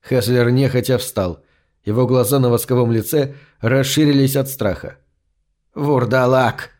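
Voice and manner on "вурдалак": plain reading voice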